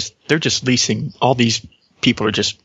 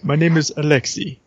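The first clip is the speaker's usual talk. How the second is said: put-on hammy Russian accent, pitched up; 4 semitones higher than usual. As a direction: introduce them in a thick Russian accent. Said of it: "I know [name] does a Russian accent".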